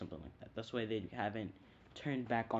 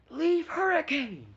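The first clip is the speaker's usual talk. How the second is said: high, loud, drawn-out battle cry; attack shout; 12 dB louder than usual. Retold or imitated. Naruto unleashing Leaf Hurricane, battle shout. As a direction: yell the move name. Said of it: "does taijutsu and says".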